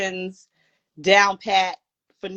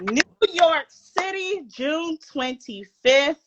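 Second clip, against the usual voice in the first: announcer voice; 7 semitones higher, slower than usual. Acted. Announcing tour stop dramatically.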